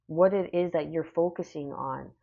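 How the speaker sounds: ordinary delivery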